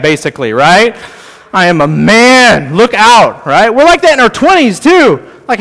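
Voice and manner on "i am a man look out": mock macho teen boast; playful imitation of a bragging teenager; proud teen. Teen boasting about age.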